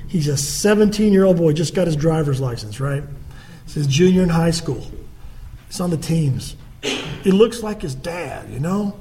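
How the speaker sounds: ordinary delivery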